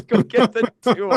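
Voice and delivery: mock dad yell. Echoing the dad's yell, laughing.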